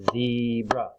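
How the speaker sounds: "zebra" as two stretched beats; split into syllables. Shown as a demonstration. Teacher clapping syllables for children, "zebra" split in two. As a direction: draw out both parts of "zebra". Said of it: "clap out the different parts".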